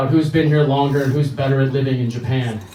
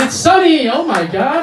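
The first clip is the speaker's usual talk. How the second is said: put-on very high, loud kid squeal; excited kids; 11 semitones higher, 4 dB louder than usual. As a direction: squeal it like thrilled toddlers.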